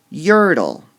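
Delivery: Yertle loud with the first syllable high; stressed Yertle. Shown as a demonstration. Teacher helps students hear the stress in Yertle. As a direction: punch the YER of Yertle. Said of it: "they might need a little help".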